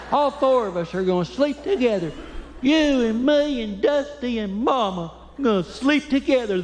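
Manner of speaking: put-on high childish plea, slowing at the end; little boy voice. Son telling dad they'll sleep with mama, high and childlike. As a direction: play the stubborn little son.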